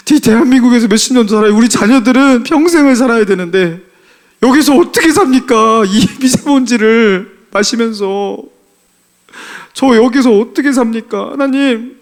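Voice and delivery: high, loud desperate plea to God; pleading prayer. Retold or imitated. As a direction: beg God, voice rising. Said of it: "이 기도가 나오는데 ... 눈물을".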